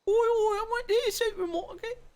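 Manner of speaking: very high, loud caricature British accent; full-on Cockney. Shown as a demonstration. Showing how far the accent could go, full-on British. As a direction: squawk it in an over-the-top Cockney accent. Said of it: "go full on".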